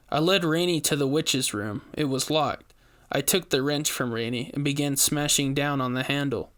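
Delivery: usual speaking voice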